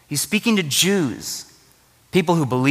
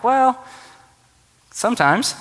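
skeptical; 8 semitones higher than usual. Retold or imitated. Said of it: "I'm always really skeptical".

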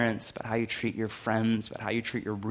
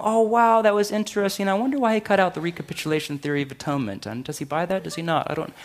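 higher-pitched mock intrigued chatter; casual churchgoer musing; 6 semitones higher, faster than usual. Congregant walking home chatting about the sermon, mock musing. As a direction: lightly mimic an idle theology buff.

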